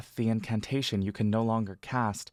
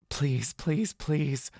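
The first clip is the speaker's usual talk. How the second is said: muttered begging; 4 semitones higher, much slower than usual. A narrator's reading. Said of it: "you mutter to the Everlight".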